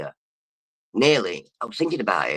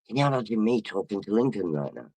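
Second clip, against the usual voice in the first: ordinary talk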